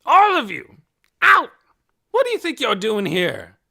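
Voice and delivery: loud, high furious order; angry shout. A narrator's reading. Hoyt ordering the whole crowd off his land, angry shout. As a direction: throw them all out.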